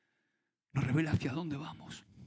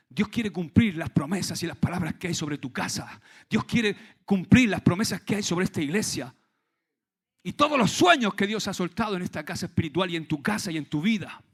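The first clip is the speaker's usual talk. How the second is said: fiery preaching; 3 semitones higher, 8 dB louder than usual. Real, not acted. Preaching at full force.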